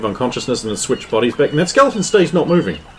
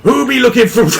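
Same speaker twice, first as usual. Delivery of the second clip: a hammed-up pirate, higher and louder; pirate captain; 6 semitones higher, 5 dB louder than usual. Pirate captain hailing the party, hammed-up pirate bellow. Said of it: "The pirate captain be like".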